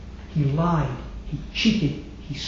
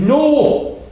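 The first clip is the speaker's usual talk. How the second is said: crowd shout; 8 semitones higher, 6 dB louder than usual. Retold or imitated. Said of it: "And they said".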